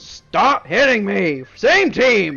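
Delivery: loud high shout, slow and pleading; angry yell. Acted. Giant yelling at number fifteen, loud and high. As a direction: yell it at the ally who hit you. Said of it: "he looks at him and goes".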